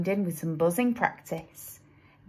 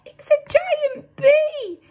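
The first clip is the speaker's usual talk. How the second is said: very high, loud, slow frightened bear squeal; terrified squeal; 7 dB louder, slower than usual. A narrator's reading. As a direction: shriek in panic at the giant bee. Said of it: "squealed the bear".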